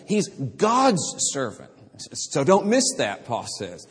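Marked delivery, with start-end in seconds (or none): 0.1-1.7 "God's" weighted, slow and deliberate; stress on GOD'S. Shown as a demonstration. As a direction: dwell on "God's" before servant. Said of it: "God is emphasized".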